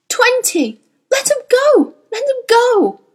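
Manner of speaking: very high, anguished cries; agonised begging at the top of the voice; frantic pleading. A narrator's reading. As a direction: scream out a desperate plea. Witch pleads for her demon.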